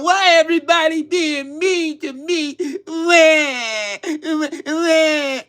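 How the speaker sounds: high, wailing fake sob; mock a grown man crying like a baby; mock crybaby whining. Mocking a billionaire whining online, high fake crying. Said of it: "He on here crying".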